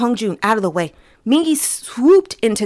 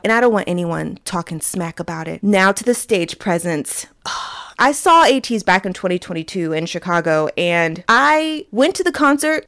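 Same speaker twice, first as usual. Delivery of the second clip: normal talking voice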